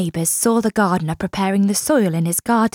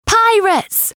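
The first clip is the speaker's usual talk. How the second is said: much higher, excited cry; excited exclamation; 11 semitones higher than usual. A narrator's reading. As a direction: announce it with wonder. Excited story opener.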